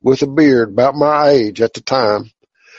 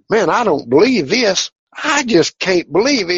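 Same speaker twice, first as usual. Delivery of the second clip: pitched higher, retelling his astonishment; amazed disbelief; 7 semitones higher than usual. Retold or imitated. Telling the healer the shoulder pain is gone, amazed.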